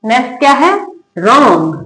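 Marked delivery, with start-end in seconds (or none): 1.2-1.8 रॉन्ग drawn out, begun straight on R; silent W. Shown as a demonstration. Teacher showing learners the reading, रॉन्ग with silent W. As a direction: model रॉन्ग with no W sound. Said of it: "उसका sound नहीं आ रहा".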